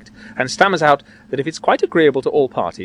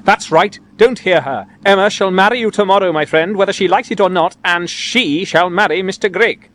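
raised, insistent ruling; emphatic, higher-pitched order; old gentleman's cry; 6 semitones higher, 4 dB louder than usual. A narrator's reading. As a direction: lay down the law loudly. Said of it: "cries the old gentleman".

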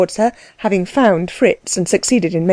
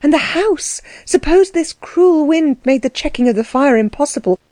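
plain reading voice

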